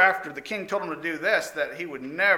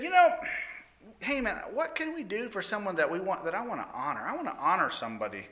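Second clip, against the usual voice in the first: lightly raised, eager king voice; a king keen to honor someone; 6 semitones higher, 4 dB quieter than usual. Acted. King asks Haman for advice, lightly eager.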